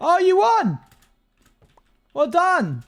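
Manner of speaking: high, loud delighted cheer; excited cheer. Real, not acted. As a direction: cheer the win. Roulette win, excited cheer.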